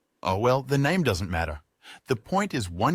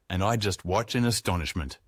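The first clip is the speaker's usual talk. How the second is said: ordinary delivery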